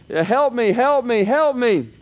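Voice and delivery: high pleading cry of help; mock yell for help. Man on a cliff yells up for help, pleading. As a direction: hang off the cliff calling for help. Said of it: "he yelled".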